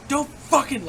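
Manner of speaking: high, loud warning shout; yelled warning. Retold or imitated. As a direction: snap a threat at them. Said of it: "And I'm fucking like".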